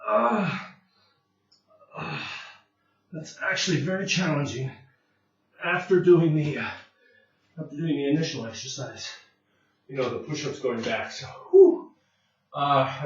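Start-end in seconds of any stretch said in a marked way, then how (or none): 0.1-0.6 effort grunt. Real, not acted. Straining through push-up forward. 11.5-11.9 loud whew. Real, not acted. Right after the hard set.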